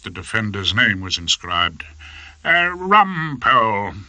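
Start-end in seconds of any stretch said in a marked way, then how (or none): 2.4-3.9 high, slow, lordly judge voice; a pompous high-court judge, much higher; fussy old judge. Acted.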